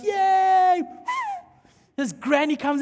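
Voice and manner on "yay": put-on high, louder little kid cheering; bright child's yay; kid's cheer. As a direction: cheer like an eager five-year-old. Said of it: "he goes".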